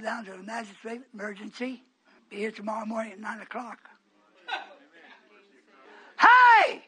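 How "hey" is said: sudden loud high call; shout. Real, not acted. Preacher shouting at the congregation. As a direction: call out to grab the room.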